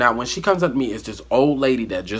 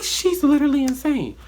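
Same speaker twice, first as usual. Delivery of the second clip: much higher, disbelieving; incredulous aside. Retold or imitated. Telling viewers what she thought of her friend, high and disbelieving. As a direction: say it like she can't believe the nerve. Inner reaction to friend's request.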